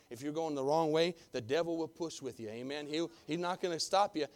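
ordinary delivery

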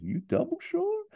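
very high, squeaky hunter; double-check in a high squeak. Acted. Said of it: "Hader's a bit squeaky".

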